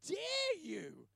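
high, drawn-out indignant challenge; outraged. Retold or imitated. Man retelling his angry answer to God, outraged. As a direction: hit DARE hard, high and stretched. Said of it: "my response was almost like".

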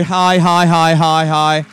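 ordinary talk

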